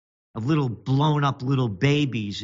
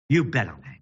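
low defiant anger; 4 semitones lower, much faster than usual. Real, not acted.

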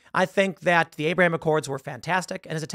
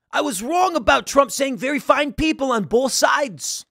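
higher, louder, brash New York guy voice; a loud, blunt admission of being wrong; Michael Rapaport impression; 8 semitones higher, 4 dB louder than usual. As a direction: do the actor's rough outer-borough bark. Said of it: "That's how he talks".

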